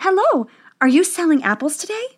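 lightly voiced princess with an upward lift; young princess. A narrator's reading.

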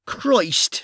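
urgent gruff Irish cop; Irish detective voice. Acted. Bunny exasperated.

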